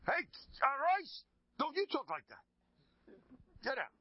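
very high, loud scolding; acted outraged shout. Rabbi to the whispering gabbai, acted outraged shout. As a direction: throw him out in staged indignation.

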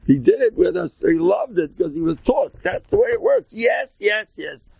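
high, exuberant retelling; excited. Retold or imitated. Excited retelling of his rebbe.

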